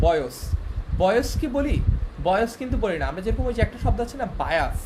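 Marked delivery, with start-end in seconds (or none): 0.1-0.4 put-on বয়স ending in a plain s, boyos; rejected s pronunciation. Shown as a demonstration. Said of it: "এটা কিন্তু আমরা বলি না". 4.4-4.8 বায়াস ending in a clear s; dental s demo. Shown as a demonstration. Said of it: "দন্তস্বর উচ্চারণ কিন্তু".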